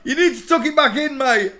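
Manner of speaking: loud, high laughing tease. Real, not acted. Host teasing the man in the photo, loud laughing tease. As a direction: tease through a big laugh.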